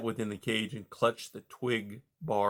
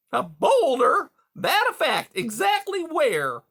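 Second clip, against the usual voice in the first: much higher, louder hearty voice; hearty beaver; 6 dB louder than usual. A narrator's reading. Beaver Mister Conrad greeting caged Rye, high and hearty. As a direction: boom out a jovial salesman beaver.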